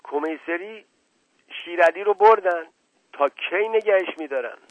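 high, startled cries of disbelief; alarmed outcry. A narrator's reading. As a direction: cry out urgent questions in shock. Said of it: "چند فریاد از دهانها شنیده شد".

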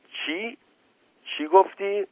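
the news hits her like a blow; shocked. Acted. Naneh Khanom jumps up at the news, shocked high cry.

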